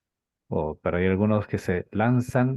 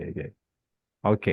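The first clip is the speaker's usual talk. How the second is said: usual speaking voice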